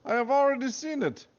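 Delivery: high pleading fake Russian accent; hammed-up Russian accent. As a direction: beg the commander in a thick hammed-up Russian accent. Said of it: "the Russian accent there".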